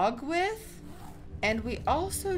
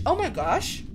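excited; 2 semitones higher, 4 dB louder than usual. Real, not acted. Finding the wish sprite.

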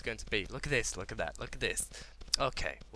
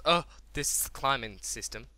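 usual speaking voice

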